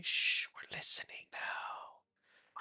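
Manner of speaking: shushing whisper, slow at the end; quiet stage whisper. Acted. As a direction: hush them, then whisper slowly. GM shushing players in character.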